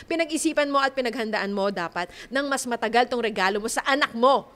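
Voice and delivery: ordinary talk, nothing marked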